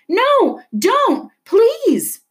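high, loud pleading; a girl shielding her father from a beating; desperate cry. A narrator's reading. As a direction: beg the policeman to stop. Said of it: "she cried".

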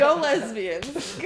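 slow, laughing through the joke; cracking up at your own joke. Real, not acted. Joking, laughing.

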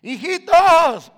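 long, high, loud drawn-out call; Jesus calling the disciples from the shore; shout from the shore. Acted. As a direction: call across the water to the disciples. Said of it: "alguien gritó desde la orilla".

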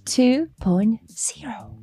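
breathy whisper, drawn out; hushed slow teaser; whispered name. Acted. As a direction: whisper it like a secret reveal. Announcing dedication, whispered.

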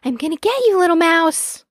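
high, playful chasing voice; hooting Baby Owl. A narrator's reading. Baby owl chasing mouse in tag, playful hoot. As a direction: hoot a teasing threat, pitched high. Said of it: "hooted Baby Owl".

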